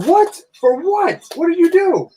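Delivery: very high mock-outraged squawk; imitating livid girlfriend. Girlfriend learning he got pulled over, imitated livid disbelief. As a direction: fire off incredulous questions in a high voice. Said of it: "she is livid".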